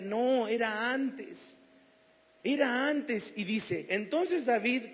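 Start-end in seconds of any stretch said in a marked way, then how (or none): 0.0-3.3 slow, loud, higher, falling on No and Era; emphatic insistence. Real, not acted. Preacher insists to the congregation, slow and loud. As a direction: drive it home slowly.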